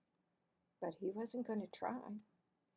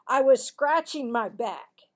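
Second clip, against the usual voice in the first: loud protest; defensive boy; 3 semitones higher, 15 dB louder than usual. Acted. Marvin to Cassie, loud defensive denial. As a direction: deny it hotly.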